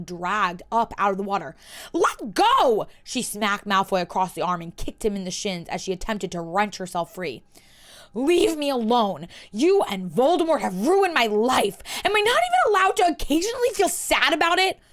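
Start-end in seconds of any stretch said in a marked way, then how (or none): 1.9-2.9 sharp shout to break free. Acted. Hermione struggles against Malfoy. 8.1-14.8 louder, higher, breaking into a cry; upset outburst. Acted. Drunk Hermione rages at Malfoy.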